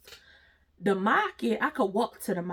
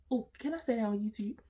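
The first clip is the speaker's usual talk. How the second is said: whisper; 7 dB quieter than usual. Real, not acted. Aside worried about YouTube rules.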